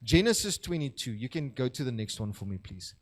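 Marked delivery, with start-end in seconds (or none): none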